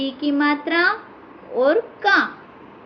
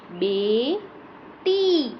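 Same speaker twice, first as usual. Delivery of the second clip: slow dictation; 5 dB quieter, much slower than usual. Shown as a demonstration. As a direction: stretch out बेटी very slowly and softly. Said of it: "बड़ी ई की मात्रा लगा दी". (बहुत धीरे और धीमी आवाज़ में)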